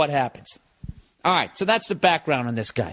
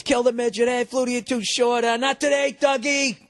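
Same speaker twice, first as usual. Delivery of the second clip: high-pitched jeering from the stands; mock heckler's call, pitched up; crowd heckling; 9 semitones higher than usual. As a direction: imitate fans taunting a player. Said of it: "everybody's giving Flutie shit".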